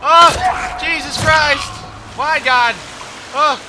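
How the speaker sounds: loud, high shouts of shock; frightened outcry. Real, not acted. Player just jump-scared, frightened outcry. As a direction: cry out to heaven in fright.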